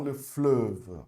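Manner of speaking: fleuve said slowly, eu with rounded lips; slow French demo. Shown as a demonstration. Teacher modelling French for students, slow and careful. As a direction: stretch out le fleuve clearly. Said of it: "faz a boquinha do O".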